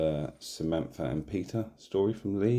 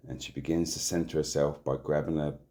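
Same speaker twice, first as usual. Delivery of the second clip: ordinary delivery, nothing marked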